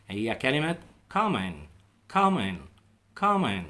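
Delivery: common said as kamen, the o after c swallowed; silent o in common. Shown as a demonstration. Teacher shows students common with a silent o. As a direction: say common with no clear o sound, as kamen. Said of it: "لاحظ حاجة، أنا في النطق".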